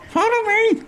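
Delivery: very high squeaky voice; high-pitched hologram call; Bob's voice. Acted. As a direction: chirp it like a little robot. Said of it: "says Bob".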